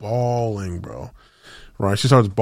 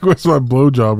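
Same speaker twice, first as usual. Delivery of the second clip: "blowjob" said louder and blunter; mock crude punchline, louder; louder blunt correction; 3 semitones higher, 6 dB louder than usual.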